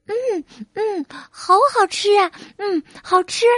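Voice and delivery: high squeaky little mouse voice, savouring each bite; a small mouse munching cheese in delight; happy country mouse eating. A narrator's reading. Country mouse tasting city cheese, high delighted mouse voice. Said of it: "乡下老鼠高兴地吃着奶酪".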